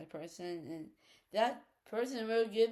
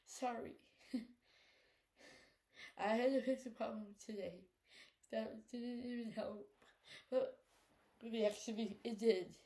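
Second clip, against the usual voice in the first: after crying; 7 dB quieter than usual. Real, not acted. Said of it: "Sorry for the little teary eye".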